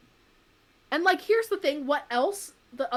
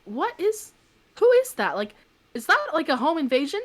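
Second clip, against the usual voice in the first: high, startled questions; alarmed husband; 7 semitones higher, 4 dB louder than usual. Retold or imitated. Husband startled by a man on the roof, alarmed. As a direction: sound suddenly alarmed at the stranger. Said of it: "my husband was like".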